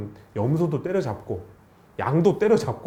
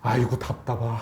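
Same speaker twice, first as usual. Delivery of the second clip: lower, weary father groaning 아이고; exasperated sigh; 7 semitones lower than usual. Retold or imitated. Preacher voicing the father's frustration, exasperated sigh.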